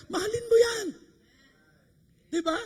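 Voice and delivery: high-pitched exhorting shout; emphatic cry. Real, not acted. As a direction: cry it out as an urgent charge. Pasigaw na payo sa choir. (mataas na pasigaw na payo)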